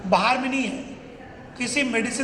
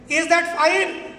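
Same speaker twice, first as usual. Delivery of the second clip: higher, drawn-out rallying question; rousing check; 5 semitones higher, 4 dB louder, much slower than usual. Real, not acted. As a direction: stretch it out and push for a big answer. Asks again after weak audience reply.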